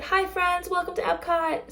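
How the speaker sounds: cheerful cast member greeting guests; cheery cast greeting. Acted. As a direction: call out a bright welcome to a family.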